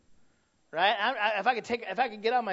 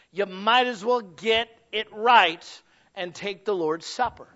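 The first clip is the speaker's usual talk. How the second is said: slow, louder preaching, every phrase weighed; deliberate preaching; 7 dB louder, slower than usual. Acted. Pastor warning the church about taking the Lord's Supper, slow and loud. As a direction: lay down the warning slowly to the congregation.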